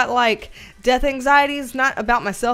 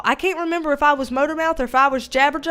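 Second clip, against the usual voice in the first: ordinary talk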